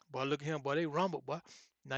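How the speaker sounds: ordinary talk, nothing marked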